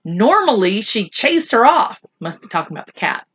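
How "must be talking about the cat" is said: soft, fast aside; quiet aside. Real, not acted. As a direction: murmur it to yourself. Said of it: "quiet aside to listeners".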